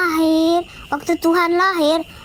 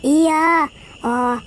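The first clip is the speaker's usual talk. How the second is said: Mary voice; 4 semitones higher, much slower than usual. Acted.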